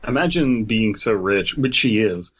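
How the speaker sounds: normal talking voice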